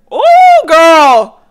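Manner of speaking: high, loud delighted squeal; delighted shriek. Real, not acted. Thrilled the nail lady befriended her, a loud excited shriek.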